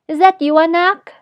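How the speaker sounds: high, loud rising query; father calling out. A narrator's reading. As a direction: call it out as a question through a door. Father calls through closed door.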